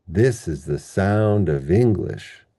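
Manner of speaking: slow, stressing THIS, SOUND and ENG in "This is the sound of English"; measured demo with beats on this, sound and the start of English; sentence-level word stress. Shown as a demonstration. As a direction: land firmly on THIS, SOUND, ENG-lish, small words light. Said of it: "That's sentence level word stress".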